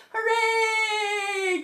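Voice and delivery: high, long sung-out hooray; drawn-out cheer. Real, not acted. Teacher cheering children after a rhyme, drawn-out high cheer. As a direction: stretch out a big high cheer.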